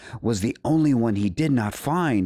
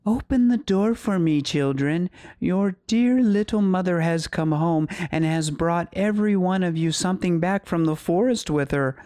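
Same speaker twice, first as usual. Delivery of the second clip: high-pitched fake mother voice; wolf's softened voice; 8 semitones higher than usual. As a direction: coax as a wolf posing as mother, high and sweet.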